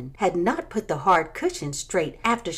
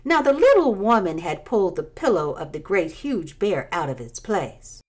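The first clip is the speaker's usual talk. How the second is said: plain reading voice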